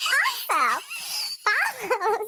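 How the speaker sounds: high laughing, eyes watering; can't stop laughing; laughing to tears. Real, not acted. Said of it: "Eyes are leaking".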